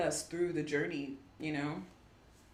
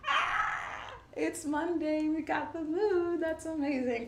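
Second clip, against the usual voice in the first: much higher, delighted squeal of discovery; light up with glee over the moon card; excited surprise; 11 semitones higher than usual. Real, not acted. Reader thrilled to pull the moon on Monday, excited.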